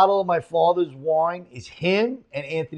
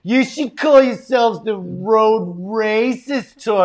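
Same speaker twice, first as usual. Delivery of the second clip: higher, louder, slow mocking Karen; 5 semitones higher, 4 dB louder, much slower than usual. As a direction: drag out the accusation like an outraged woman.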